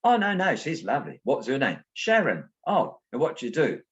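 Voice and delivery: high-pitched back-and-forth Cockney banter; Cockney accent. Retold or imitated. Old man quizzes boy about his date, high Cockney imitation. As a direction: imitate two Cockneys trading quick questions and answers. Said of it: "who's also a Cockney".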